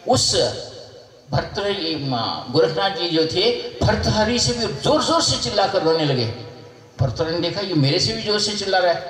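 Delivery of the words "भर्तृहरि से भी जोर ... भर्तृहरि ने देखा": plain reading voice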